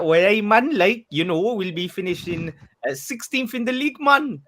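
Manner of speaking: high, loud mock Geordie accent. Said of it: "can you answer in a Jamaican accent".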